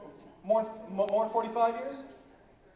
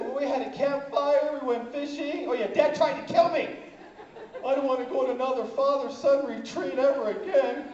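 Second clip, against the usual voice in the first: put-on high, loud whiny kid; hammed-up child complaint, high and loud; upset boy; 5 semitones higher, 5 dB louder than usual. Son telling mom Dad tried killing him, upset. Traumatized boy reports to his mother.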